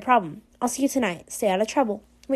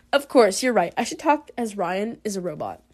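ordinary delivery

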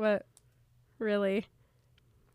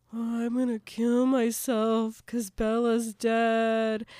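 slow, drawn-out mopey mock voice; mocking imitation; 4 dB louder, slower than usual. Co-host mocks Edward's dramatic despair, slow mocking drone. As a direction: drag it out in scornful mimicry. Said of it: "for him to now be like".

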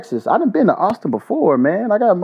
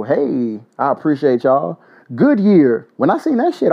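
normal talking voice

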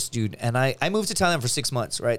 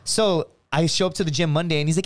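usual speaking voice

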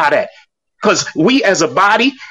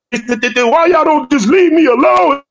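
higher, slow whiny imitation of a tearful plea; mock crying plea; 8 semitones higher, slower than usual. Talking about [name] crying on a video, a higher, slow mock plea. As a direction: plead it slowly like a man in tears, half mocking.

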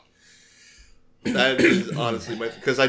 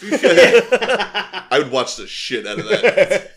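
slow laughing emphasis; 6 semitones higher, 5 dB louder, much slower than usual. Real, not acted.

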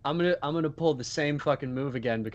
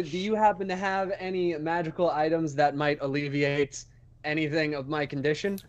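normal talking voice

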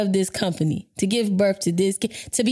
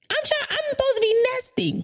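high-pitched, imitated weepy whine; tearful complaint. Expectant mother crying to partner, mock tearful complaint. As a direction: whine it as if close to tears. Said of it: "I started crying".

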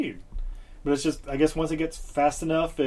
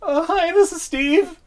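put-on high, loud teen voice on phone; squeaky sixteen-year-old answering phone; over the phone; 6 dB louder, slower than usual. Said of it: "we have to act this out".

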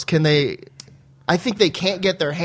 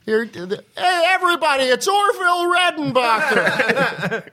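pitched-up gushing fan; high excited Foley voice; 8 semitones higher than usual. Retold or imitated. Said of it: "he's like".

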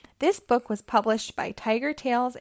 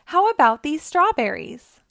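high, louder eager suggestion; excited shout; 5 semitones higher, 5 dB louder than usual. A narrator's reading. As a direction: call out a find, keen. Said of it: "he shouted".

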